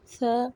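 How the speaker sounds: सा with a light breath of air between the teeth. Shown as a demonstration. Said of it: "हल्की सी हवा की आवाज़ निकलेगी". (सा, दांतों के बीच हल्की हवा के साथ)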